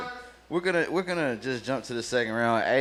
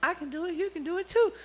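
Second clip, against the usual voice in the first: mock cheerful voice; 4 dB quieter than usual. Mocking eager guests.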